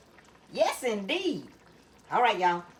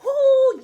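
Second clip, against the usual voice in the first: high excited whoo; 13 semitones higher, 8 dB louder than usual. Real, not acted. Excited about the smell of cooking.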